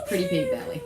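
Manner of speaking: very high cooing squeak; pet voice. Real, not acted. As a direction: coo at the dog's belly. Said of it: "Baby voice and pet voice is the same voice".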